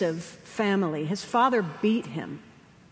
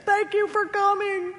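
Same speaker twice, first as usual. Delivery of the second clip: tender thanks; 10 semitones higher than usual. Retold or imitated. Dying mother thanks her son.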